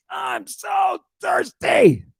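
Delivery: high, slow yelled cry; yelling. Retold or imitated. Jesus on the cross yelling, high and slow. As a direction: yell it out from the cross, stretched. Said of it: "cross yelling".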